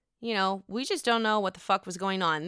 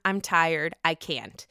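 ordinary talk

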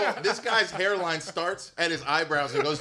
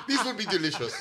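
happy mock African accent, raised pitch; African parent impression; 4 semitones higher than usual. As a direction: relish it like an imitated African parent. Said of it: "hear someone emulate their African parent".

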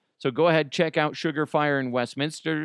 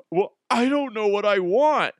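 high, louder pouty whine; sulky son; 9 semitones higher, 5 dB louder than usual. Retold or imitated. Son sulking at the counter, high pouty whine. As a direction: sulk like a mad kid. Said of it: "He's like".

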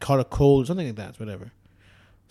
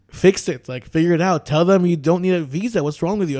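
imitating an irritated, loud demand; louder, pitched up, mock exasperation; exasperated demand; 4 semitones higher, 8 dB louder than usual. Retelling an officer telling him off, exasperated.

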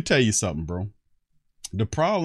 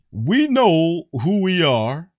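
high, loud, drawn-out mock quote; slow mocking repeat; 7 semitones higher, 6 dB louder, much slower than usual. Host repeats player's quote for ridicule, slow and loud. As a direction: stretch each word out mockingly. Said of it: "Let me say that again".

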